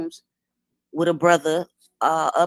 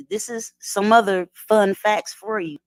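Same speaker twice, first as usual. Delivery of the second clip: ordinary talk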